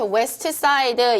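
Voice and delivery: "West Side" with every syllable sounded, we-seu-teu sa-i-deu. Shown as a demonstration. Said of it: "음절별로 이렇게". ("West Side"를 음절별로 트까지 살려서)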